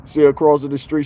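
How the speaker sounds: normal talking voice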